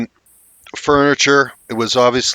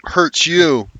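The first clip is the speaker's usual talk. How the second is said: put-on defiant child; 6 semitones higher than usual. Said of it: "And they're saying".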